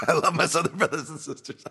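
high, loud, laughing through the words. Real, not acted. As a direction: laugh while hastily making amends. Laughs at his own accent slip.

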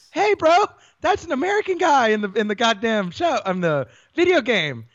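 calling out the absurdity; excited. Real, not acted. Mocking rant about Guile.

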